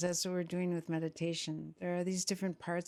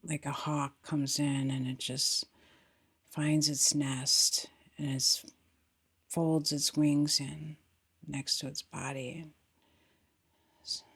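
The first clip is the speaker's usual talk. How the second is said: guided meditation; 2 semitones lower, 5 dB louder than usual. Acted. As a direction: guide them gently into the body, unhurried, falling phrase ends.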